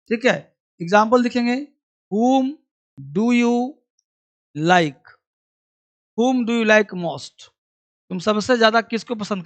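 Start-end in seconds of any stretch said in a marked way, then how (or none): 2.1-7.5 whom do you like most spelled out word by word, very slowly; very slow model reading. Shown as a demonstration. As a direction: read it out slowly for the class. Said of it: "teacher models an example". (धीरे-धीरे पढ़कर समझाना)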